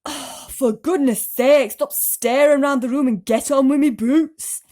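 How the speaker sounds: loud, slow, exasperated character voice; impatient centipede. A narrator's reading. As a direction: bark it at a dawdling boy. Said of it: "the centipede said".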